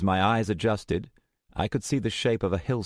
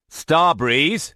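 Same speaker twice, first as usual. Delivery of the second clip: higher, louder angry call of her name; angry question; 10 semitones higher, 8 dB louder than usual. Acted. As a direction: demand an answer. Angry at being blocked.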